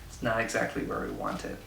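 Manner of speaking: ordinary talk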